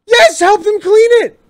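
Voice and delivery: high, loud, stunned yell of agreement; mock outraged excitement; excited shout. Real, not acted. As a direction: belt it out in disbelief. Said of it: "I lost my voice for a second there".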